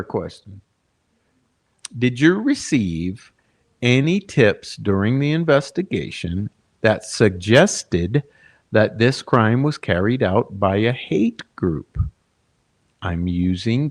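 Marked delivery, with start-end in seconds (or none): 2.0-12.0 very slow, spelled out word by word; mockingly slow. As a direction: drag it out as if for a slow thinker. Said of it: "so I'll speak real slow".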